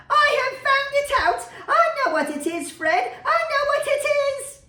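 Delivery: very high, loud triumphant shout; excited cry. A narrator's reading. Plump sister crying out she has guessed the game, very high and loud. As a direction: burst out with the answer. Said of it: "cried out".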